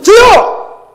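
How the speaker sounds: high, loud cry of me; eager shout. Shown as a demonstration. Pastor showing the congregation how to answer, shouted eagerly. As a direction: shout it out like a volunteer. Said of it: "이렇게 얘기해야 돼요".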